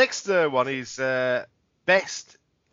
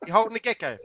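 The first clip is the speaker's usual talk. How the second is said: higher, teasing set-up question; lightly acted interviewer feeding a gag; mock questioner; 6 semitones higher than usual.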